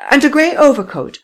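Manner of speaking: high, loud, firm woman's voice; insistent lady. A narrator's reading. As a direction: dig in and repeat it.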